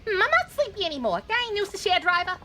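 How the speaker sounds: put-on high New York accent, brash; a brassy New York lady warning him. Passenger warns the driver, high New York accent. Voices female taxi passenger.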